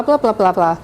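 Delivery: usual speaking voice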